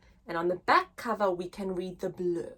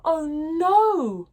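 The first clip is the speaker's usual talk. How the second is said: lively, sing-song picture-book reading; reading to children; 3 semitones higher, 6 dB louder, slower than usual. Acted. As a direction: read it big for little listeners. Teacher reads the bear hunt story.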